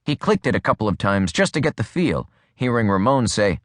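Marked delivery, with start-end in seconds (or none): none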